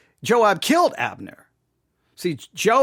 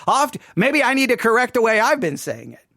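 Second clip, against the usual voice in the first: usual speaking voice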